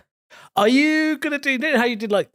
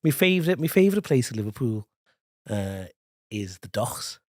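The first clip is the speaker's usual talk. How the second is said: hesitant Scouse, fading softer; Scouse accent; 4 semitones lower, 4 dB quieter than usual. Shown as a demonstration. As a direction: attempt a Liverpool accent on request. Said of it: "can you do your Scouse now".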